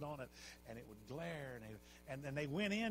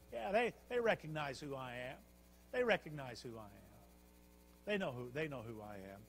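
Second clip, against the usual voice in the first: smug, lowered voice of a vain ruler; puffed-up king; 2 semitones lower than usual. Acted. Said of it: "he got all puffed up in himself".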